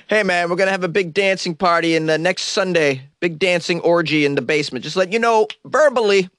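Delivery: casual heads-up to a cop. Acted. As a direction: pitch it up like a cheeky partygoer informing police. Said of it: "You can just tell the cop".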